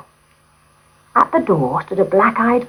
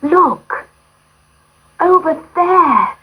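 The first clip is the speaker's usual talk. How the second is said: higher and louder, pointing out; girl spotting the palace; excited cry; 11 semitones higher, 5 dB louder, slower than usual. A narrator's reading. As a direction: call out in delight. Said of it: "cried Gerda".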